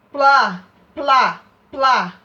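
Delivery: plá loud and exaggerated, P then L then A; sounding out plá. Shown as a demonstration. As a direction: pop each plá out hard. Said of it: "Eu falei o P, mas saiu o som do P e saiu o som do L".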